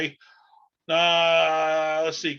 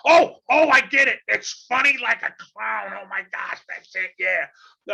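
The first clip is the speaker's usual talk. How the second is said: loud, high-pitched comic outbursts; Gilbert Gottfried; 9 semitones higher, 7 dB louder than usual. Acted. Podcast host opens the show impersonating a comedian, Gilbert Gottfried impression. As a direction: exclaim it high and loud, dragging out the punchlines. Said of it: "if that's a good Gilbert Gottfried impression".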